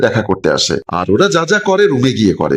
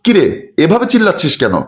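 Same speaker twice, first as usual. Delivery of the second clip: mother's rebuke; 7 semitones higher than usual. Acted. Mother scolds son shouting.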